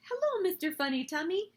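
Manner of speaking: high, sing-out playful greeting; mild mock yell, pitched high; storybook yell. A narrator's reading. Laughing children greet each other, high playful mock yell. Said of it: "and yelled".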